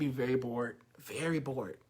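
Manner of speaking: low, very quiet deadpan; monotone. Shown as a demonstration. Coach shows listeners how dull monotone gets, low bored drone. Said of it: "talk like that a long time".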